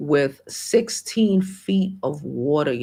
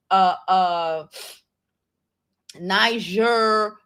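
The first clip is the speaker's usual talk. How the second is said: ordinary talk, nothing marked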